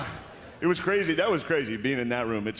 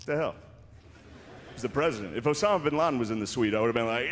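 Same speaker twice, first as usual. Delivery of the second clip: ordinary talk, nothing marked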